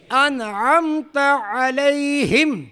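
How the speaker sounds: انعمت علیہم slow, both ع squeezed; throaty ain recitation. Shown as a demonstration. Teacher joining the words for students, slow throaty انعمت علیہم. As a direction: chant انعمت علیہم with long vowels. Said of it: "وسط حلق سے".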